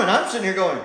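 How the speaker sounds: ordinary talk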